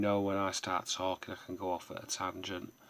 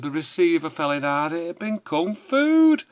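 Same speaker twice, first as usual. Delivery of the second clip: rising to a much higher, louder yell; building shout; 10 semitones higher, 14 dB louder than usual. Acted. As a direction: build to a roaring punchline. Poem builds to a punchline.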